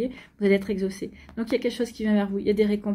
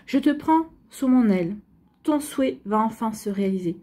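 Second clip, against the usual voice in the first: card message reading. Shown as a demonstration. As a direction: proclaim it like an oracle.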